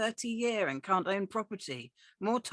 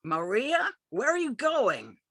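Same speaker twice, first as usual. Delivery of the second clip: high, louder call across the street; 8 semitones higher, 6 dB louder than usual. Acted. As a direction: yell after the daughter from the door. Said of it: "yells from her doorway".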